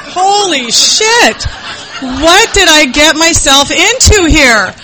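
high, slow, laughing in disbelief. Real, not acted. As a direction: laugh it out in shock. Reacting to the escape plan.

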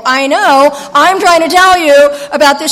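practically shouting I know; exasperated. Retold or imitated.

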